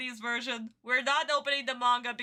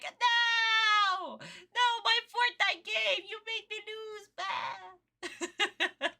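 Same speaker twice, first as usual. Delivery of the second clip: high, whiny mock kid tantrum; screaming little kid; 9 semitones higher, much slower than usual. Streamer mimicking a kid screaming at the screen, high mock tantrum. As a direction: imitate a kid wailing over a lost game. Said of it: "little alpha kid screaming at the screen".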